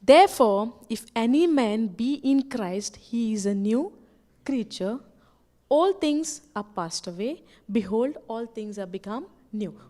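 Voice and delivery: deliberate low-pitched Bible recitation; scripture reading. Real, not acted. Reads the Bible verse aloud.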